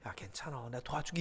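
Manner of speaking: soft reassurance. Acted. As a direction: gently soothe a worn-out friend. God voiced comforting Moses. (부드럽고 작게 달래는 목소리)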